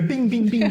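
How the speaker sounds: high, laughing pinball bings; mock pinball sound effect, half-laughing. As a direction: chirp it like a pinball machine. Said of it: "like watching a pinball machine".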